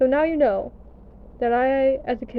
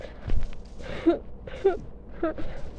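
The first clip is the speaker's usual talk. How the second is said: breathy laugh; 6 semitones higher, 5 dB quieter than usual. Real, not acted. Laughing at own adulthood.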